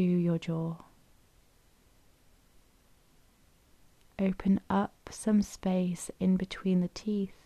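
unhurried, even delivery, leaving room to breathe; guided meditation. Acted. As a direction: guide them gently into stillness, phrase by phrase.

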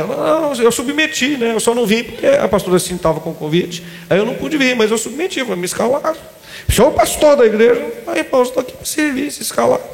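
high-pitched, meek self-justifying voice; humble servant. Acted. Pastor explaining he submitted to the rota, meek. Acting out his humble reply.

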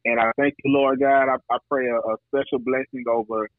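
ordinary talk

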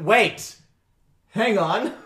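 shouted in an NPC's voice; calling out. Acted. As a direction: yell after them to wait. NPCs call after the fliers.

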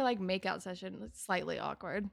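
ordinary delivery

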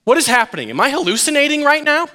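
high, climbing bewildered questions; hesitating disbelief. Acted. Disciple unsure he really sees Jesus, hesitant disbelief. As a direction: question it as if doubting your eyes.

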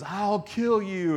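high, drawn-out mock threat; mock kid threat. Raging kid coming after him in the pool, mock threat.

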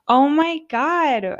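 high, slow, gushing oh my God; touched delight. Real, not acted. To listeners about Natalie's gift, a high, slow, gushing gasp. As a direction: swoon over a friend's kindness. Moved by friend's sweet gesture.

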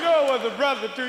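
a cheeky puppet piping up; dummy voice. Pretend. Comic talking to his puppet, high dummy voice.

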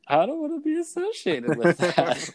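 high mock horror; mock horrified voice. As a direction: squeal it like someone shocked. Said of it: "you don't go".

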